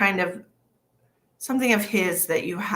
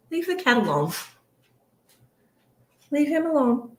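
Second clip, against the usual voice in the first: higher pet-scolding voice; talking to pet; 7 semitones higher than usual. Real, not acted. Owner telling George to leave the cat, lightly high. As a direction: gently tell the dog off.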